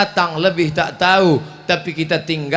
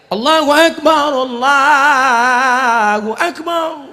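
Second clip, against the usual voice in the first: higher and louder, slow called azan; too loud azan; 8 semitones higher, 6 dB louder, much slower than usual. Shown as a demonstration. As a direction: belt it out like a mosque call. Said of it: "Tapi tidak kuat macam itu".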